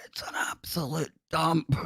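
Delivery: high, breathy whisper in a mock posh accent; crazy posh accent. Host mocking south-siders, high posh-accent whisper. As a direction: sneer it like a snooty posh Glaswegian. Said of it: "they'll put on this crazy posh accent".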